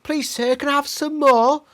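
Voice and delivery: high, pleading mock orphan voice; Oliver Twist. Host quotes Oliver Twist in a high pleading orphan voice. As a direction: beg like a cartoon Victorian orphan. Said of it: "Oliver Twist".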